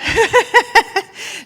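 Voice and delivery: high, giggly real laugh; laughing again. Real, not acted. Still amused that Teresa guessed right, laughing high. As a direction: let the laugh bubble up high.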